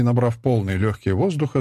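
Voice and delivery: ordinary delivery, nothing marked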